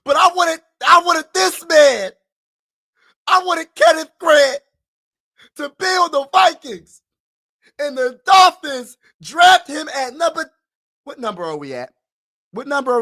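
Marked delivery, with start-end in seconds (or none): 0.0-10.5 high, loud aggrieved ranting; real heartbroken yelling; frustrated shout. Real, not acted. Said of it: "I'm frustrated".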